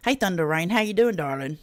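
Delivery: ordinary talk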